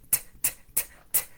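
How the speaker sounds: crisp unvoiced t sound repeated; letter T sound. Shown as a demonstration. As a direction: tap out a clean t, t, t. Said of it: "that says t".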